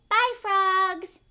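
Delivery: high, loud bright farewell; waving goodbye to the frogs; cheerful goodbye. Acted. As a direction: call it out happily to the frogs. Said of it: "We bow and say".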